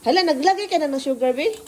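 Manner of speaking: high, loud startled question; alarmed. Real, not acted. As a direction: caught off guard, raise the alarm. Alarmed at sugar in pancakes.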